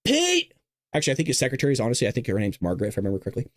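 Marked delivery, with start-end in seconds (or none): 0.1-0.5 high mock-presidential call of "Pete"; Trump impression. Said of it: "He says".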